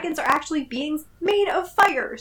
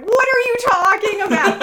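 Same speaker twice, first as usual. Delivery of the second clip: incredulous; 7 semitones higher, 5 dB louder than usual. Real, not acted. Incredulous at dragon magic.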